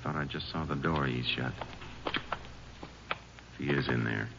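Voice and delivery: low, soft words so the man won't bolt; hushed. Acted. Ranger warns partner not to spook Charlie, hushed. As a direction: keep it down, creeping closer.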